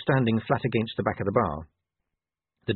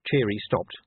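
plain reading voice

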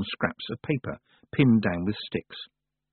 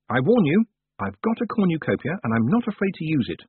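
high, urgent threat; defiant warning; 7 semitones higher, 4 dB louder than usual. A narrator's reading. Tiffany threatening the Wintersmith with her cornucopia, defiant and high. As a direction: pitch it up and stand firm.

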